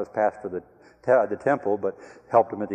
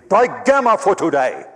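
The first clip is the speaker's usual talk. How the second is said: stern professor verdict; 8 semitones higher, 7 dB louder than usual. Retold or imitated. Professor fails silent student.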